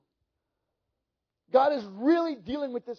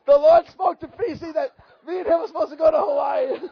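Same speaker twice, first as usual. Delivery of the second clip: laughing loud repeat; 4 semitones higher, 8 dB louder than usual. Retold or imitated.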